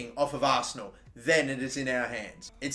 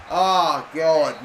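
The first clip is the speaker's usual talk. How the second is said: frustrated groan; 6 dB louder than usual. Real, not acted. Attack blocked again.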